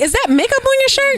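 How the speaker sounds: high-pitched retold challenge; incredulous questioning. Retelling how she confronted her date, high incredulous question.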